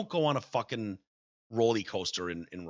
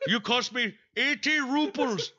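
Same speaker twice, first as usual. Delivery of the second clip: high, slow mock Indian accent; 12 semitones higher than usual. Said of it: "quoting the Indian man".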